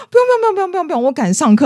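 夸张模仿同学急着推辞; exaggerated brush-off. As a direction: ham up his panicked escape.

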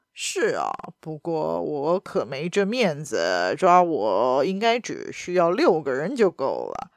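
a low, smug reply from the baron; lightly voiced, mocking baron; amused baron. A narrator's reading. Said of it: "男爵笑了起来".

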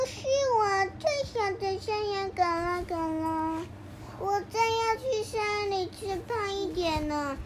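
very soft, slow little goat voice; tiny voice. A narrator's reading. Smallest goat answers the troll in a tiny voice. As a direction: make it small and timid. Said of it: "用好小好小的声音回答".

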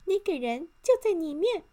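high, loud elf voice; little elf. A narrator's reading. Elf points Mr. Arrogant to the small hole, high elf voice. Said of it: "小精灵指着小洞说".

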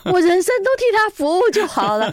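high, loud comic exasperation; hammed-up indignant exclaiming; mock outrage. Host jokes in mock horror.

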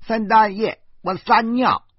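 put-on high little boy's shout; child calling out. Nephew calling his uncle again, high child's shout. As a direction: yell it like a small boy. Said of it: "豆豆又喊起来了".

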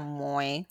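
没 said as Taiwanese "muê", low and soft; own dialect pronunciation. Shown as a demonstration. Showing co-host the family word for porridge, 没 as "muê". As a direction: say 没 as the Changhua-style "muê". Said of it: "可是我是讲".

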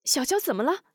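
alarmed; alarmed question. Acted. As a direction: demand what happened, startled. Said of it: "惊问".

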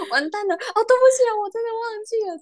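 high, fast laughing mock apology; laughing panic. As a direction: giggle through a panicked sorry. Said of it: "我那时候想说".

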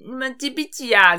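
high, slow, prying voice for the intruder; nosy intruder's question. Retold or imitated. Imitating the intruder asking scores. (学他插嘴，声音拉高放慢)

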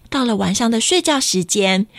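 ordinary delivery

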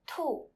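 "tu" falling sharply from high; fourth tone. Shown as a demonstration. Teacher introducing Chinese word for rabbit, fourth tone on "tu". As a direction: say "tu" loud, pitch dropping fast. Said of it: "Chinese word with the fourth tone is tu".

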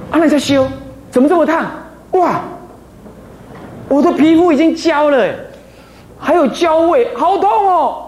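high, hammed-up yelps of a burnt hand; mock pain cry. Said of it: "然后说". (夸张地模仿被烫到的叫痛声)

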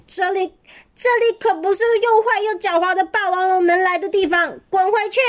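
high jeering shout; shouting from afar. A narrator's reading. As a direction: yell it from a safe distance. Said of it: "只敢在远处喊着".

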